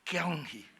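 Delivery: "Kyung Hee" low and soft; low-toned Hokkien "Kyung Hee"; low Hokkien intonation. Shown as a demonstration. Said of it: "orang Hokkien ngomongnya itu rendah".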